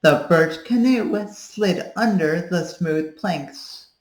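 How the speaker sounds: slow, careful practice reading; practice sentence read. Shown as a demonstration. Coach reading the Hartford sentence slowly. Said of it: "then you'll do the Hartford sentence and be like".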